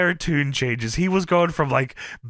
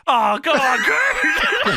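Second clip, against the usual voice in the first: mock exasperated shout; 7 semitones higher, much slower than usual. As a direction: call it out like a tired peacemaker. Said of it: "you can hear Scott Steiner go".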